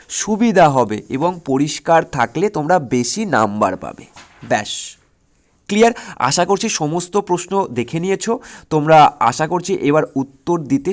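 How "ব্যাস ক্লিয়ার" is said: normal talking voice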